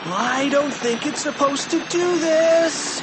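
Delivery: high, strained yell to be heard over a racket; alarmed high-pitched boy's shout; shouting over noise. A narrator's reading. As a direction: shout it in alarm above the din. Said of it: "shouted George over the horrible noise".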